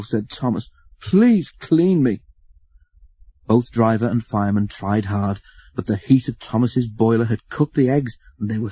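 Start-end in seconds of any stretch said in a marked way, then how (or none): 1.0-2.2 pleading. A narrator's reading. Thomas pleads, slow and high.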